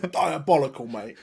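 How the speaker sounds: put-on high, loud go at a London accent; hammed-up London accent through a laugh; Butcher's London accent. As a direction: have a jokey stab at a London accent. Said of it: "I think Butcher does a better London accent than I do".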